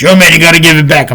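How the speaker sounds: imitated street kid, quick and insistent; rushing tough kid. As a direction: mimic a pushy kid, all in one burst. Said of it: "Then he comes rushing".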